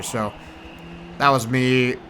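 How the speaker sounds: ordinary talk, nothing marked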